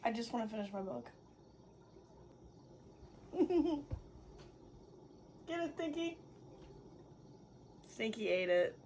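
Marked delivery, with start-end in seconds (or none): 0.0-1.1 tired reader muttering; fast, softer grumble. Real, not acted. Wearily pleading. 5.5-6.2 higher, softer coax to the cat; encouraging the cat to eat; coaxing pet. Real, not acted. Talking to the cat.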